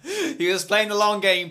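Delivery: loud, slow, emphatic revelation; drawn-out mock-serious exposé; mock conspiracy reveal. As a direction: announce it like a grand conspiracy.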